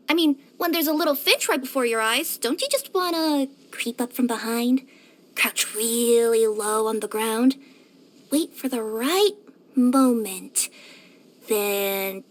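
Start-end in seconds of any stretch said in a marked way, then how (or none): 3.7-12.2 low, slow, drawn-out stalking; predatory suspense. Acted. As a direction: creep through it slowly, pausing before the pounce. Describes stalking a finch.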